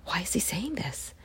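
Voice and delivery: hushed, startled whisper; a reader's stunned whisper; shocked whisper. Shown as a demonstration. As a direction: breathe it out in disbelief. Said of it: "you go".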